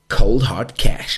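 slow, loud, relished cold hard cash. Acted. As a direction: savour every word like a gangster. Drawn out, loud, slow line.

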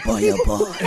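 low-pitched mock of a colleague; Wayne. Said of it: "Wayne is like".

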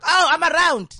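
high, loud and eager; cheerful. Retold or imitated. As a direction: chirp it brightly. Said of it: "and I was like".

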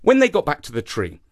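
ordinary delivery

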